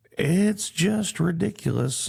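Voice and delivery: slow, high, incredulous outburst; drawn-out exasperated "It's just ridiculous"; exasperated disbelief. Real, not acted. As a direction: spell out how absurd it is. Exasperated rant about border hypocrisy.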